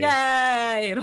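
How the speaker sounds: loud, high, drawn-out yay; cheering. Real, not acted. Player celebrating having written it down, cheering. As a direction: cheer along, stretching the word.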